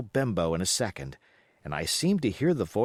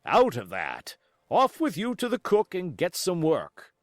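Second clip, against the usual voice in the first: cried out; 6 semitones higher than usual. Acted. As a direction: drive the boy off. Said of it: "he cried".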